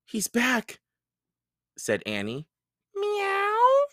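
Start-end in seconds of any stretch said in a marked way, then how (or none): 0.0-0.8 put-on high girl's voice; excited girl. A narrator's reading. 2.9-3.9 very high cat meow. A narrator's reading.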